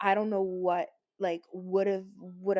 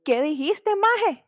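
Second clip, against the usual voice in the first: loud, high-pitched indignant Spanish challenge; Spanish retort, loud and high, offended; 9 semitones higher, 9 dB louder than usual. Retold or imitated. Retold confrontation in Spanish.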